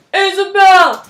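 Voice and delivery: loud call after someone leaving. Acted. Simon calling after Isabel as she leaves, loud call. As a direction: shout her name as she walks off.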